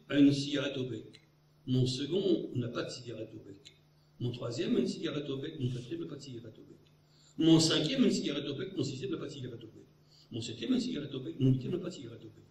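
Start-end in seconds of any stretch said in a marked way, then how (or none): 5.6-12.4 fast rapid-fire riddle lines; rattling off fast. Retold or imitated. Orlando teases at recess, fast rapid-fire recital. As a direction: rattle it off quickly. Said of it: "il poursuivait en accélérant".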